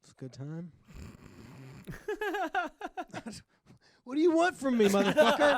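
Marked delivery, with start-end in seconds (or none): 0.8-5.6 higher, louder, laughing protest; real flustered laugh-shout; exasperated laughing outburst. Real, not acted. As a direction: laugh it out, cornered. Will pressed to do accent.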